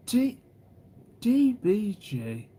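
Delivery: high gasp, then a very slow, stretched D B G; shocked gasp. Real, not acted. Streamer reacting to a chatter's message, gasping and stretching the name. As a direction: gasp and drag out the letters in shock.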